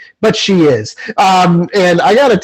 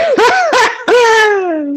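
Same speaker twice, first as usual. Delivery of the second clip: breathless airy laugh, way up high; real laugh caught off guard by the story; high wheezing laugh. Real, not acted. Laughing at a co-host's shirtless theater story, high wheezing laugh.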